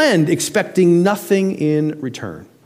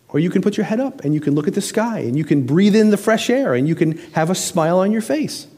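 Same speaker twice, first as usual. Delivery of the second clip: normal talking voice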